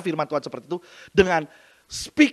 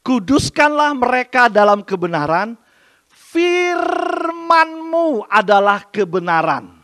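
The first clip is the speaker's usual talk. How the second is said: louder, higher, very slow scripture proclamation; khotbah lantang; 5 semitones higher, 9 dB louder, slower than usual. Shown as a demonstration. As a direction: stretch the verse out, proclaiming it. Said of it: "Saya ulangi". (ulangi ayat dengan lantang dan sangat lambat)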